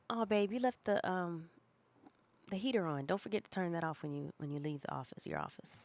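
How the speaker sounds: soft, kind reminder to partner; gentle reminder. Shown as a demonstration. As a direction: say it softly and lightly. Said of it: "the benefit of the doubt could, for me, would be".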